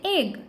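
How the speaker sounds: एग starting on ए. Shown as a demonstration. Teacher showing learners another E sound, एग with ए. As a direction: open एग with a clear ए vowel. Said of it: "ए के रूप में भी".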